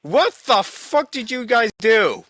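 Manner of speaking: ordinary talk, nothing marked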